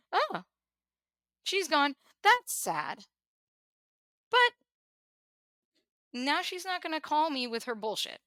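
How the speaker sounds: a hint of a callous relative, played light; imagined relieved family. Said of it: "that's how I felt the reaction would be".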